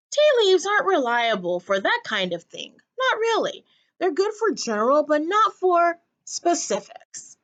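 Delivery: a hint of a genteel old woman; fussy old lady. A narrator's reading. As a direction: explain it airily like an old actress. Old ladies hedge vaguely.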